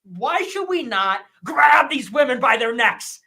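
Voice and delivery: heated outburst at the viewers; co-host finds it aggressive. Real, not acted. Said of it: "that was aggressive".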